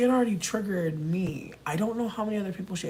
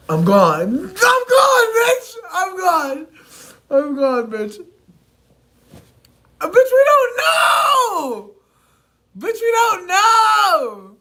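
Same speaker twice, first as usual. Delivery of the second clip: shouting with laughter; 12 dB louder, much slower than usual. Real, not acted.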